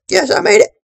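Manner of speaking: high-pitched yes of triumph; excited squeal. Real, not acted. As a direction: squeal the win. Sudden win in the level.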